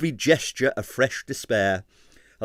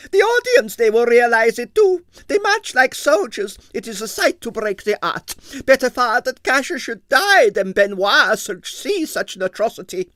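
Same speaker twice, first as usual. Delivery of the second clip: high, loud tragic lament; dramatic ballerina; 13 semitones higher, 7 dB louder than usual. A narrator's reading. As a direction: mourn it like a tragedy.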